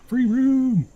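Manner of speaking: very high, loud cry; finding a free room, shouting it out; excited shout. Shown as a demonstration.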